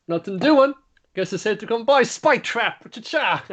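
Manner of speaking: high, chirpy little critter voice; cute mascot voice. Acted. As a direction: pipe up high like a tiny cartoon animal. Cute mascot voiced checking trap.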